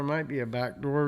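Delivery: ordinary talk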